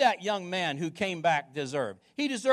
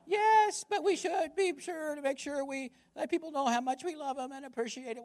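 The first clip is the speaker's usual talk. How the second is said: high prissy sing-song trailing softer; mocking churchy commenter; 7 semitones higher, 4 dB quieter than usual. Pastor imitating a Twitter commenter, high mocking voice. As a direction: lampoon the sanctimonious reply. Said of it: "that's how I imagine they talk".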